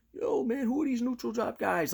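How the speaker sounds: high-pitched mock amazement; other channels' reaction. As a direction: imitate puzzled onlookers in a high voice. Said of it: "they're like".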